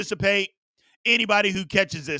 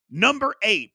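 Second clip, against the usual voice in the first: ordinary talk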